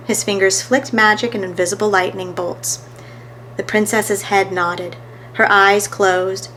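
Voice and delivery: plain reading voice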